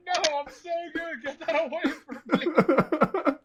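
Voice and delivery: high, laughing refusal, slow at the end; mock pleading refusal. Refusing the smelling salts again, high laughing plea. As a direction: push it away in a squeaky panic. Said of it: "I'm like".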